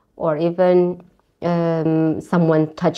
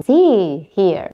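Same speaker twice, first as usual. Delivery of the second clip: ordinary delivery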